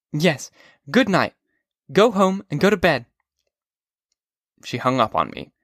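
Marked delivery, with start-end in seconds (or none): 0.1-3.0 put-on high, sharp girl's voice; a girl woken by a drunk caller; young woman Sally. A narrator's reading. Sally answers drunk Holden, annoyed.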